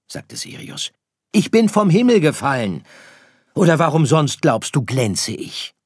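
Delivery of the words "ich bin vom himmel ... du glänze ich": high, loud little-star voice; insistent little star. Acted. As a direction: argue back in a small hero's voice. (beharrlicher kleiner Stern)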